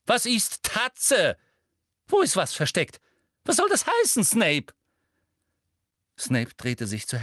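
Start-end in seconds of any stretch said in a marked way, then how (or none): 0.1-4.7 hoch geschriene, drängende Fragen; schreiend. A narrator's reading. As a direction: fordere schreiend eine Antwort. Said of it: "schrie Professor Umbridge". (aufgebracht und hoch gerufen)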